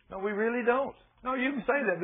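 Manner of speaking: usual speaking voice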